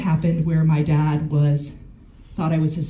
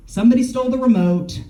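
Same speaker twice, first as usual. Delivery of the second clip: higher, petulant complaint; complaining dad; 6 semitones higher, 3 dB louder than usual. Retold or imitated. Imitating the father's complaint on the phone, higher. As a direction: whine it up high like an accusation. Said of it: "It was like".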